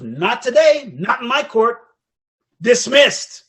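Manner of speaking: loud, emphatic courtroom pronouncement; stern judge's ruling. Retold or imitated. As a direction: lay down the law, loudly. Acts out judge dismissing lawyer.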